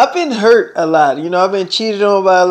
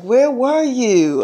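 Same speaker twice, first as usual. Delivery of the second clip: imitated girlfriend, higher and drawn out; a slow, higher-pitched mock demand from a miffed girlfriend; girlfriend with attitude; 5 semitones higher, much slower than usual. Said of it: "she had a little attitude".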